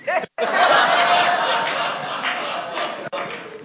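airy high giggle; high breathy laugh. Real, not acted. Preacher laughing.

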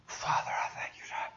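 whispered, breathy and unvoiced; whispered prayer. Little girl praying over a stranger's wrist, whispering. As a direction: pray under your breath.

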